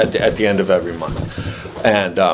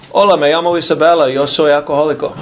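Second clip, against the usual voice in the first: another caller; 5 semitones higher, faster than usual. Retold or imitated. AA members pass the phone.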